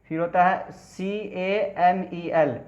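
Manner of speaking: slow letter-by-letter spelling, "सी ए एम ई एल"; spelled out slowly. Shown as a demonstration. Teacher slowly spells "सी ए एम ई एल" for students. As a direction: spell "सी ए एम ई एल" out one letter at a time for the class. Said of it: "इसको पढ़ते हैं camel".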